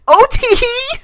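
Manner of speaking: put-on high, cutesy giggling girl; giggly girl. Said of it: "what gender voices to do".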